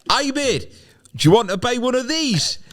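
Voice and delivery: high, chirpy Newcastle hawker; Geordie seller. Acted. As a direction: Geordie trader calmly offering a paratrooper a deal. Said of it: "the guy goes".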